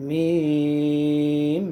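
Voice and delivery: মিম drawn out with lips pressed for the m; held long, lips closed. Shown as a demonstration. Teacher showing viewers how lips meet, long and held. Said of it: "ঠোঁট মিলাই দিবেন".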